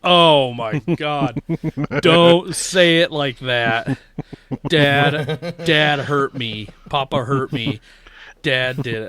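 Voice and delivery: loud, slow, drawn-out dismay; overwhelmed dad, loud and slow; panicked protest. Retold or imitated. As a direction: plead in mock horror. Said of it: "And I'm like".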